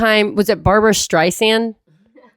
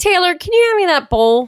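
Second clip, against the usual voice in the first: imitating mom; 10 semitones higher than usual. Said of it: "puts him to work".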